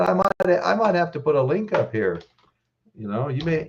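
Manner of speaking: usual speaking voice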